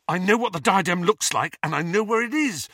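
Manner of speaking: rushed, urgent words tumbling out; Harry blurting what he's figured out; talking fast. A narrator's reading. Harry tells friends where diadem is, talking fast. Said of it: "talking fast".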